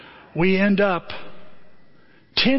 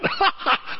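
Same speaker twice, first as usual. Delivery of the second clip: short high laugh; chuckling preacher; high burst of laughter; 12 semitones higher than usual. Real, not acted. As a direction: laugh at your own punchline. Laughing at own joke.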